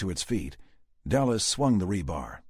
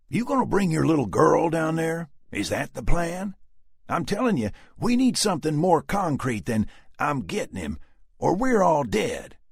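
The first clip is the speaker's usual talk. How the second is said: stern Southern warning; 10 semitones higher, 6 dB louder than usual. A narrator's reading. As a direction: lay it out hard. Dallas arguing hard.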